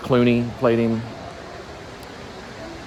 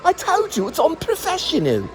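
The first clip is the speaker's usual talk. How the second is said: much higher hammed-up scolding voice; mock the boss losing it, pitched way up; boss flipping out. Boss flips out at the crew, high mock scolding. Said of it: "he was like".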